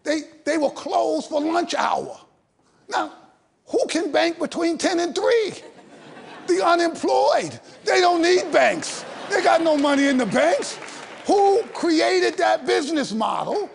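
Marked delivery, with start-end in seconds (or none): 3.7-13.7 indignant shout. Real, not acted. Ranting about bank hours.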